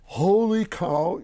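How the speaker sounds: high, loud, slow with laughter; overjoyed. Real, not acted. Telling how he met his hero, gleeful excited shout. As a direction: burst out in gleeful disbelief.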